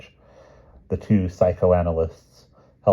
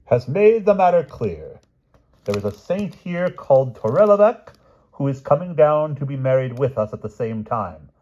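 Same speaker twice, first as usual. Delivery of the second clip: cheerful; 8 semitones higher, 7 dB louder than usual. Acted. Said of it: "replied the princess cheerfully".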